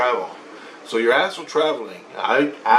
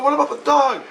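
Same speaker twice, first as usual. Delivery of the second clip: high-pitched retold protest; 14 semitones higher, 3 dB louder than usual. Husband argues to stay with dog, high protest. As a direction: argue back in a raised pitch. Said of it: "And I go".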